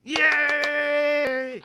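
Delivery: high, loud whoop of celebration; excited cheer. Acted. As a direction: cheer like the crowd just went wild. Host cheers guest's arrival.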